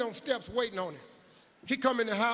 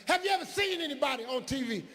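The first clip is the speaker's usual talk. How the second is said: much higher, disapproving teacher voice, imitated; high mock teacher; 6 semitones higher, faster than usual. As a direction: play the shocked teacher, pitched up high. Teacher voice in story.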